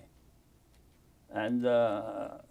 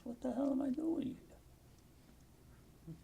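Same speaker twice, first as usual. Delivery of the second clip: slow, high, softer, in disbelief; stunned disbelief; 14 semitones higher, 10 dB quieter, much slower than usual. Retold or imitated. Recalling a visit to Israel, slow stunned disbelief. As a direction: let it fall out slowly, bewildered.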